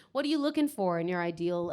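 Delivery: usual speaking voice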